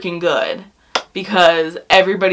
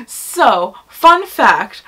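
usual speaking voice

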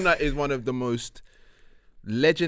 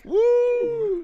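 excited cheer. Real, not acted.

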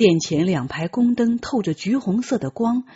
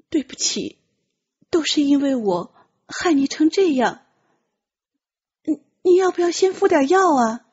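put-on raised, apologetic young eunuch; guilty young voice; 6 semitones higher than usual. Tian Qi apologizes to the wounded Ji Zheng, guiltily.

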